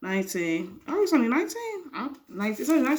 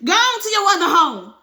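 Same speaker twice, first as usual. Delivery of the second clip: higher, louder yelling; 7 semitones higher, 7 dB louder than usual. Acted. Wife sending husband to his other home, yelling. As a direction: fling it after him.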